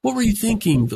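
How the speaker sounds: harsh startup. Shown as a demonstration. Spouse scolding partner in a conflict, sharp and high. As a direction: demand an answer, exasperated. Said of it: "our tone goes up".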